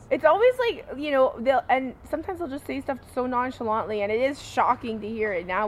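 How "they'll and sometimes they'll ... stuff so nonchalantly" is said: ordinary talk, nothing marked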